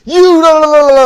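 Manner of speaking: loud, high sing-song taunting la la; mocking la la la. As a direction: toss off a big mocking singsong. Mocking noise after the call.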